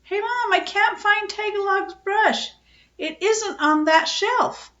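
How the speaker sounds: put-on high, loud boyish shout; boy shouting up to his mother from the basement; shouting up the stairs. A narrator's reading. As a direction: holler it up from the basement. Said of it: "a shout came up the basement stairs".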